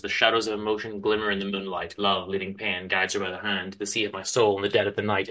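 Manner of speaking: soft, measured reading of song lyrics; hushed lyric recital; poem recital. Acted. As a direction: read it like a quiet poem.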